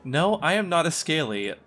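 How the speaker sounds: ordinary talk, nothing marked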